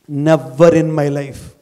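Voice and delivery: very slow, each word weighed; slow, measured vow; slow, deliberate. Shown as a demonstration. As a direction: lay out "Never in my life" slowly. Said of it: "repeated motto".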